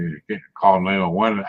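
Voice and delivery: ordinary talk, nothing marked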